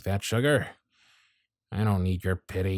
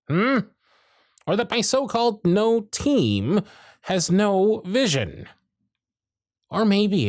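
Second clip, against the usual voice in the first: higher and louder, jabbing each question; bitter outburst; 10 semitones higher, 3 dB louder, slower than usual. Acted. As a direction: let the resentment spill over, pointed and sharp. Bitter rant about dead-end job.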